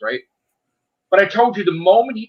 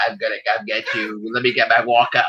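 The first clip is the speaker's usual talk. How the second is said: put-on low, soft doddering old-man voice; mock old Emperor; 8 semitones lower, 4 dB quieter than usual. Mocking the aged Emperor to his co-host, low and soft. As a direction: play a decrepit villain threatening feebly. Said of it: "Senior citizen fucking Emperor".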